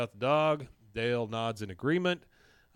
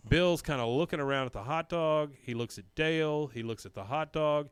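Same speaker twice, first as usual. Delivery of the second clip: ordinary talk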